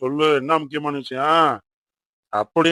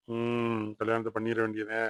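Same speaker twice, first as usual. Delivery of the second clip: low, soft murmur of a pondering father; quiet musing; 4 semitones lower, 11 dB quieter, slower than usual. Acted. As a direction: think aloud, low and soft. Father muses to himself over meal.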